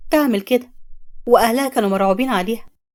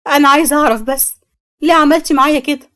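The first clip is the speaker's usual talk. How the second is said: anguished demand; 4 semitones higher, 5 dB louder than usual. Acted. Samia demands why.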